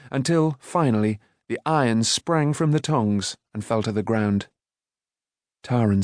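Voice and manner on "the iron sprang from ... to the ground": ordinary delivery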